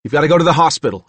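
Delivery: fast, loud urgent order. Acted. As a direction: hurry him along.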